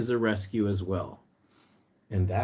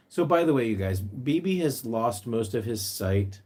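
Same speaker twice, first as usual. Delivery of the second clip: normal talking voice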